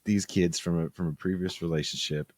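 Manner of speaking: normal talking voice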